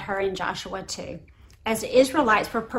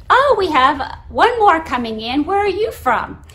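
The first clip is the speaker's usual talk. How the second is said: loud, higher imitation of a gala announcer; 6 semitones higher, 7 dB louder than usual. Swim gala announcer to a late swimmer, announcer voice. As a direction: project it like an announcer hailing a late entrant. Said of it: "the announcer at the swim gala says".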